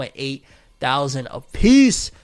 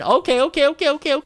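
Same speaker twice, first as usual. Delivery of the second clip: hyped up; 9 semitones higher, 3 dB louder, faster than usual. Real, not acted. Hyped for top ten.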